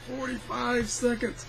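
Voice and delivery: ordinary delivery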